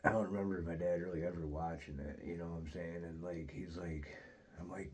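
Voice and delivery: ordinary talk, nothing marked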